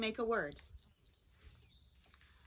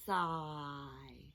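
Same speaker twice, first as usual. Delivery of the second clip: said slowly; 5 semitones lower, 4 dB quieter than usual. Shown as a demonstration. Teacher sounding out a word, slowly. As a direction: SIGH drawn out, long s and vowel. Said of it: "Let's say it slowly".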